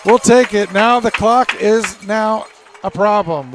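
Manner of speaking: pumped-up reaction; excited. Real, not acted.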